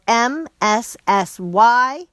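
slow, letter by letter spelling; spelling it out slowly. Shown as a demonstration.

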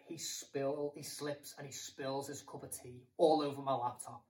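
usual speaking voice

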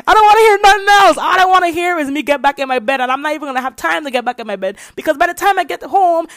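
high, loud, fast complaining; whiny kid rant. Retold or imitated. Child complains to grandma about walks, high fast rant.

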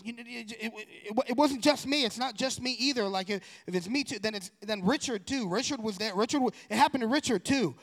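imitating a scared boy spilling a secret; panicked brother. Panicking brother blurts out more.

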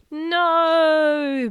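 long, loud, high bellow; huge drawn-out cry of no; booming voice. Acted. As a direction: boom it out across the sky. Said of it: "boomed the voice from the sky".

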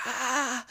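very high mock scream at modest volume; thin imitation of a scream; imitated scream. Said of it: "her scream started slowly coming back down".

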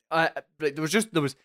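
ordinary talk

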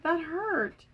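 understated acted pain; mild pained cry. A narrator's reading. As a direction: voice a little mock hurt. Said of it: "cried Ord".

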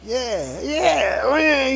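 high, rising excited outburst; cheerful, real happy. Retold or imitated. Blind man delighted by the guess, imitated excited cheer. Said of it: "he got all cheerful and he, he was real happy".

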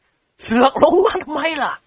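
high, slow laughing jab; can't help laughing at him. A narrator's reading. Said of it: "ก็อดหัวเราะไม่ได้". (เจ้าคุณหัวเราะเยาะนิกร)

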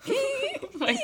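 high, slow, laughing through the words; helpless laughter; crying with laughter; laughing hard. Real, not acted. Breaking up over the story.

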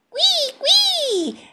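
very high, loud little squeals; joyful piercing squeaks; very happy. A narrator's reading. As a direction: squeal with baby-animal delight. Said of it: "dice Richard muy contento".

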